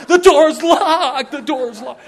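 high, very loud panicked yell. Retold or imitated. Preacher voicing John trapped at the locked door, panicked yell. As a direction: shriek it like you're trapped at the door.

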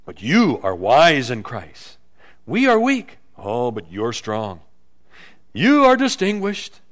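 loud mock praise, punched contrasts; sarcastic. Paul contrasting weak apostles with the strong church, sarcastic. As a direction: flatter them with obvious sarcasm. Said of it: "hear a little sarcasm in his voice".

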